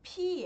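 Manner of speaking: "Pi" with a puff of air on the P; aspirated sound. Shown as a demonstration. Teacher showing the Korean consonant, "Pi" with an aspirated P. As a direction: blow the P of "Pi". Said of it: "é um som soprado".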